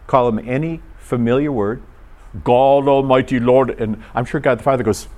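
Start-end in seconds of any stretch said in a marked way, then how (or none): 2.4-3.7 loud, level-pitched mock reverence; mock-solemn address. Worshipper addressing God too formally, mock-solemn and loud. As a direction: intone it like an over-formal prayer.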